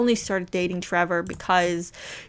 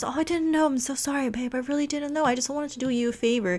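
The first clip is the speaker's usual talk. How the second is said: put-on higher, soothing, apologetic boyfriend; smooth it over with a sorry, pitched up; sweet-talking apology; 5 semitones higher, 4 dB quieter than usual. Husband smoothing it over with his wife, pitched-up apology. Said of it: "trying to smooth it over".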